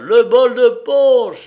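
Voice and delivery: loud, high, drawn-out call; rowdy shout. Acted. Drunken reveller demanding the punch, rowdy shout. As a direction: bellow it out to the whole room.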